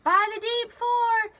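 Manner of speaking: loud, high-pitched leadsman's shout; yell. A narrator's reading. Leadsman reporting shallower water, yelling. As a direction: yell the sounding out. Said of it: "Kinsey yelled".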